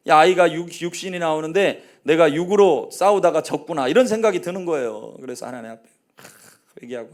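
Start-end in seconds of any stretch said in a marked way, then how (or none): none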